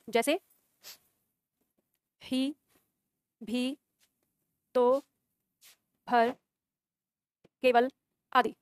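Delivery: spaced-out phrases for students to write down. Shown as a demonstration. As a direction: dictate word by word with pauses. Teacher dictating a definition. (धीमे-धीमे लिखवाना)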